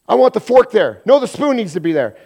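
loud, high, fussy complaint; mock bickering shout. Bickering spouse fussing over where the fork goes, loud and petty. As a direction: whine it like a petty quarrel.

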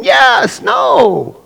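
Paul insisting. Acted. Paul and God arguing, shouted exchange. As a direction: shout the back-and-forth argument. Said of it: "Paul's saying".

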